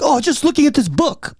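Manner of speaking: high pitched imitation of a heavy Chinese accent. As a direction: mimic her defensive excuse in a Chinese accent. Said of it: "heavy Chinese accent".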